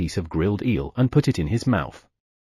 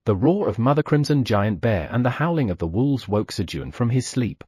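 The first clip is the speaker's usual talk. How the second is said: ordinary delivery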